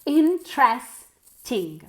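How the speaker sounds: "Interesting" slowly split, in-tres-ting; three syllables. Shown as a demonstration. Teacher repeating for learners, slow syllable-by-syllable "Interesting". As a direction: space out the three beats of "Interesting". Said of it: "Three syllables".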